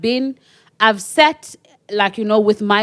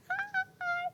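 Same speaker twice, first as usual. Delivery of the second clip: tiny, very high, soft hi; sheepish greeting; 17 dB quieter than usual. Retold or imitated. Her turning back to greet a fan, high sheepish voice. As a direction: squeak a small embarrassed greeting.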